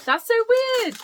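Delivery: high, loud exclamation of real surprise; astonished. Real, not acted. Vlogger unwrapping a random advent book, astonished. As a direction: blurt it out in amazement.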